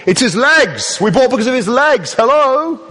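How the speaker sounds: high mock shout down through a roof hole. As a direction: yell up high, frustrated, to be heard far below. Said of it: "imagine them shouting down to the hole".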